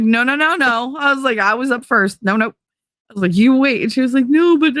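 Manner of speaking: fighting the daughter for the bathroom, mock urgent; playful squabble. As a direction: race your daughter to the bathroom.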